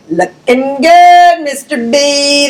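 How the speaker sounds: slow, loud, drawn-out call; cheerful greeting. Acted. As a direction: sing out a compliment. Students greeting principal.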